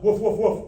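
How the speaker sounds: laughing playful woof woof woof; dog bark. Shown as a demonstration. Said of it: "can you bark like a dog?".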